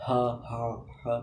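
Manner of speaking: quiet breathy হা; first attempts at হা; soft practice ha. Shown as a demonstration. Teacher showing a beginner's practice ha. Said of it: "এমনি হবে".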